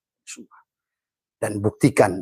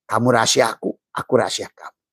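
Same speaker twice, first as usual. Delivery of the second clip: ordinary delivery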